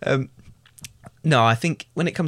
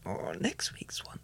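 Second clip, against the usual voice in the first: hushed secret whisper; 6 semitones lower, 14 dB quieter, slower than usual. Pretend. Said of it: "Why are you whispering it?".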